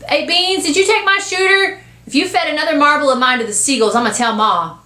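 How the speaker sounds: put-on high, quick little-boy rant; indignant tirade. Boy accusing Beans of taking his shooter, indignant tirade. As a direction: scold like an aggrieved kid brother. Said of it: "He stops his tirade".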